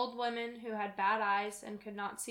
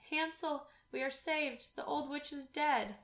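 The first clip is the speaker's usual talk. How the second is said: higher, excited call of relief; joyful cry; 5 semitones higher than usual. A narrator's reading. Gretel freeing Hansel, calling out in joy. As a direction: cry out the good news to her brother. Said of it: "and cried".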